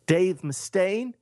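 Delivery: high, slow questioning impression. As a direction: draw the name out high as a puzzled question. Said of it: "And [name] goes".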